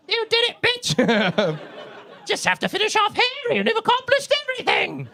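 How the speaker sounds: very high, squealed congratulation; high-pitched, hammed-up celebration; mock cheering. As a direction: cheer her on in a strained high voice. Mock cheer for the dead Queen.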